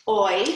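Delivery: oi said alone as a separate sound; isolated oi sound. Shown as a demonstration. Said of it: "they all make that oi sound".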